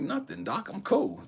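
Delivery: high-pitched, casual brush-off; breezy denial. Retold or imitated. Patient brushing off the doctor's question, breezy high denial. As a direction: retell it high, insisting all is fine.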